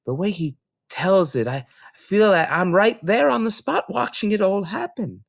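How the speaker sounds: put-on a small girl answering the librarian; Matilda, young girl. Little Matilda explaining to Mrs Phelps, young girl voice.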